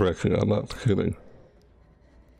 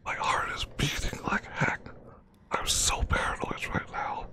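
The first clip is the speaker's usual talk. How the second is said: nervous hushed whisper; 6 semitones higher, 6 dB quieter than usual. Real, not acted. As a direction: whisper tensely under your breath. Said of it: "I literally have to whisper".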